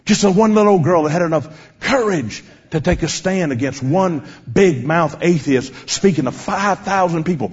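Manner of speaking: usual speaking voice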